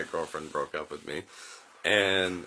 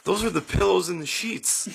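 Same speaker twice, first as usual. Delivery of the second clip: higher, incredulous explaining; mock patient friends; 11 semitones higher, 3 dB louder than usual. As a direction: tell him gently he's confused. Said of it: "they're like".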